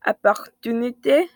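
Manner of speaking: opportunité said in French; French word. Shown as a demonstration. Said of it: "Français, c'est".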